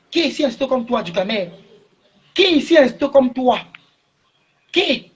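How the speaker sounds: il l'interpelle avec rage, la voix montant; colère croissante. Real, not acted. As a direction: question hurlée de plus en plus aigu. Insultes en direct. (apostrophe furieuse de plus en plus aiguë)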